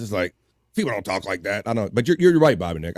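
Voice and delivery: usual speaking voice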